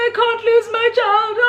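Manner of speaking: high, panicked plea; panicking mom. Retold or imitated. Mom panicking about losing her child, high and frightened. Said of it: "my mom starts panicking".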